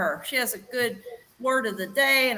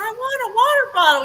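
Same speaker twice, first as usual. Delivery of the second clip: much higher and louder, bursting with joy; overjoyed announcement; 11 semitones higher, 6 dB louder than usual. Retold or imitated. Telling her worried husband about the prize, high, loud joy. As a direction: announce it like you won the lottery. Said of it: "I said".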